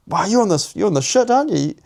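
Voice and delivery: high, loud accusation; accusing. Retold or imitated. Man confronting his mate about smoking again, high loud accusing. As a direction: call him out, voice climbing high.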